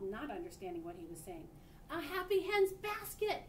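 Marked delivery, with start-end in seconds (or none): 1.9-3.4 slow, high, loud repeat; repeating loudly. A narrator's reading. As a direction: shout it again slowly so she hears. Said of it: "he repeated".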